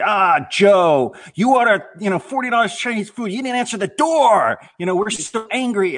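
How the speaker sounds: put-on coworker's Chinese accent voice. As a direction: mimic a coworker's mock Chinese accent on an answering machine. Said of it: "in his Chinese voice".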